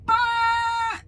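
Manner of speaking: a sharp mouth-made car horn blast; horn imitation. To her and her coworker, a van horn imitated as a buzzing brrr. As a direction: imitate the horn with your lips. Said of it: "I hear a".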